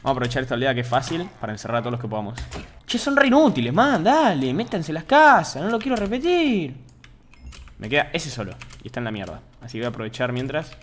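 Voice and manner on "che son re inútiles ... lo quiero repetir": higher, louder, slow scolding shout; exasperated scolding. Real, not acted. Streamer scolds villagers to get into the houses. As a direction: chew out the useless villagers.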